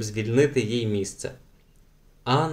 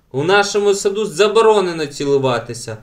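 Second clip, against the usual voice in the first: loud, higher shout; 6 semitones higher, 5 dB louder than usual. A narrator's reading. Ellen shouts at the kissing pair, loud. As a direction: yell the ban across the garden. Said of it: "крикнула Елен".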